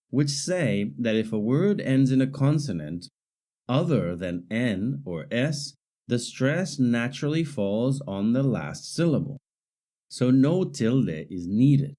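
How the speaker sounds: usual speaking voice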